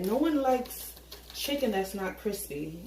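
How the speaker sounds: normal talking voice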